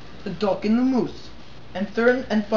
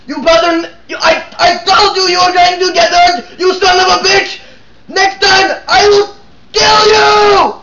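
much higher, louder, slowing rage yell; furious scream; 8 semitones higher, 8 dB louder than usual. Acted. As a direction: scream the threat in fury. Fox screams at rabbit.

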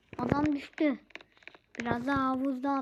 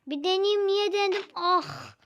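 screaming while falling; 5 semitones higher, 4 dB louder than usual. Real, not acted. Character falls off the ledge.

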